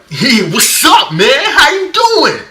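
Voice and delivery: high, loud, slowed mock greeting that ends in a shout; overhyped greeting. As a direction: ham up a way too pumped hello. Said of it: "there's no excitement".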